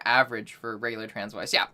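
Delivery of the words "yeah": normal talking voice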